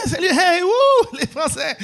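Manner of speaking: high, loud whooping hello to the crowd; mock-hype the audience in a high squeal; overexcited stage greeting. Acts himself hyping the audience.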